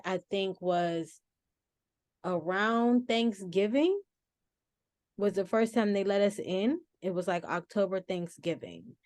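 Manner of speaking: usual speaking voice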